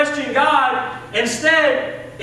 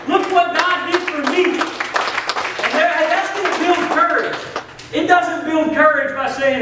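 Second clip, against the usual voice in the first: raised, high-pitched testimony; fervent preaching; 4 semitones higher than usual. Real, not acted. Preacher telling church how the promised babies came, fervently. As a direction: preach it out high, stretching the key phrases.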